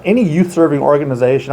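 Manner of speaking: ordinary delivery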